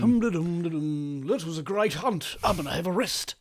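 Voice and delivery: humming, a hint of a put-on character; lightly voiced resting hunter. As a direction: play someone settling down after a hunt. Plays a hunter settling to rest.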